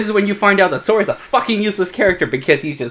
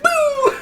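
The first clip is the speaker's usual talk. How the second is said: high squeaky boo; blast sound. Retold or imitated. Telling the plot to a friend, squeaks a high boom sound.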